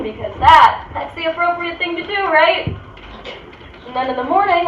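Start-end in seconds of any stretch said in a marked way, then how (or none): 0.0-2.8 higher-pitched sarcastic justification; pointed, high-pitched tag question; mock approval. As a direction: play it as if it were obviously proper. Sarcastic approval of hookup etiquette.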